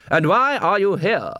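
slow, high, haughty question; imperious demand. Acted. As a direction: draw it out like an interrogating headmaster. Head clown interrogates the watchmen.

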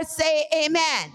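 slow, drawn-out call for amen; call and response. Acted. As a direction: draw the congregation in, slowly. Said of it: "calling for response".

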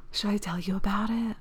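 hushed, teasing whisper; conspiratorial whisper. Real, not acted. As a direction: lean in and whisper it like gossip. Teasing secret, after a gasp.

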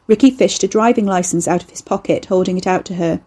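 ordinary delivery, nothing marked

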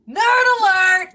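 slow, loud teasing call; teasing shout. Acted. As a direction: yell it to tease a friend. Teasing a friend.

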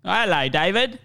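put-on British greeting; British accent. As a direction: do a general British English accent. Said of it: "British, English, general U K".

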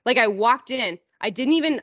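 ordinary talk, nothing marked

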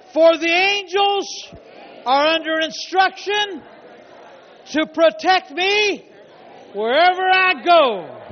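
high, loud shouted declarations; full-force preaching. Real, not acted. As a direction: belt out each phrase for the congregation to follow. Leads congregation in shouted declarations.

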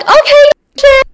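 very high, drawn-out squeak; slow, squeaky put-on okay; mock compliant. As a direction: give an exaggerated high-pitched agreement. Acts out answer to ID request.

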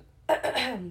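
loud throat clear. Real, not acted. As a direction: clear your throat hard. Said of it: "I've been under the weather".

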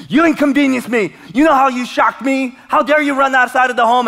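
half-joking parental telling-off; changed voice scolding. Retold or imitated. Parent scolds found child, mock angry scolding. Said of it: "I just changed my voice".

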